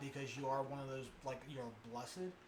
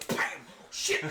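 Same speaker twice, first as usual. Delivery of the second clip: startled curse; 10 dB louder than usual. Retold or imitated. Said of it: "and he goes".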